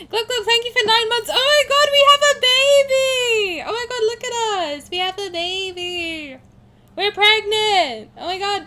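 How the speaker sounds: high, loud squeals with stretched vowels; excited squealing. Real, not acted. Streamer thanking subscribers as if announcing a baby, excited squealing.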